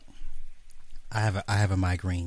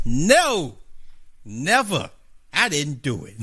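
hammed-up defiant no, never; defiant yell; 4 semitones higher, 5 dB louder, slower than usual. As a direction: shout a mock courtroom refusal, loud. Imagined trial defiant denial.

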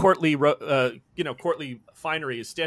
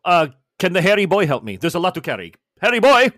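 calling out loudly; 8 dB louder than usual. Acted. Chef yells at Gregor to carry his pots, loud. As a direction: bellow across the deck for help.

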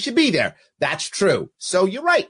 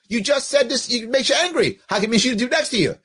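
normal talking voice

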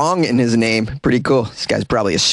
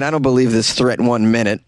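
ordinary talk, nothing marked

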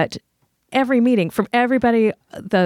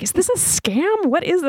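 suspicious high surprise; 10 semitones higher than usual. Retold or imitated. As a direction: pitch it up in disbelief. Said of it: "I was like".

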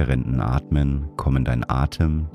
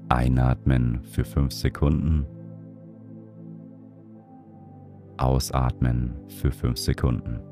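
guided meditation; 3 dB quieter than usual. Acted. Leads a breathing meditation.

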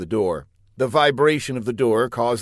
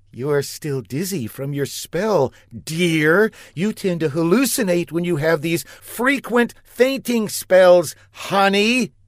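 sweet wife voice; 8 semitones higher, 5 dB louder than usual. A narrator's reading. Marcia covering for him.

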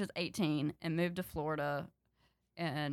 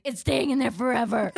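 higher, louder stubborn protest; defiant retold yell; 4 semitones higher, 6 dB louder than usual. Little sister refusing her sister, defiant retold yell. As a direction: dig in with a mock yell.

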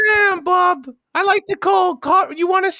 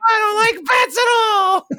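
put-on higher-pitched kid outburst; mock protest; 4 semitones higher than usual. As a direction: whine it out like an upset little boy. Child character protests about bats.

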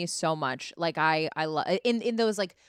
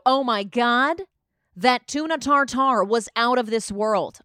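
loud, raised mock gushing; gushing parent compliment; 4 semitones higher, 6 dB louder, slower than usual. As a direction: overpraise it like an effusive parent. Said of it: "My parents always will compliment the waiter".

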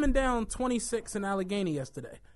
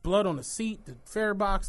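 normal talking voice

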